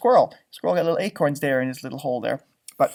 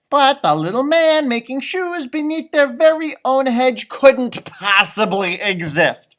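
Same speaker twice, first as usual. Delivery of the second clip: slow, louder, scornful leprechaun; grumbling leprechaun; 4 semitones higher, 8 dB louder, slower than usual. Acted. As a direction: grumble about foolish humans. Reads story, leprechaun complaining.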